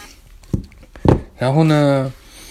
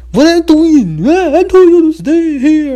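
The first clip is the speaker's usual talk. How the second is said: angry yell; 10 dB louder, slower than usual. Retold or imitated. Examiner yells in English.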